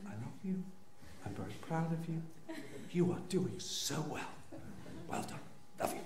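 very soft, timid encouragement; small hushed voice, placating; meek reassurance. Retold or imitated. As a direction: tiptoe round a roaring partner. Cowed husband soothing roaring wife.

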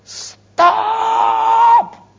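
a full high, loud shout of "Stop!"; mother screaming at a child running into the road; retold scream. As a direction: yell it like a mother seeing danger. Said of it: "screamed at Amelia at the top of her voice".

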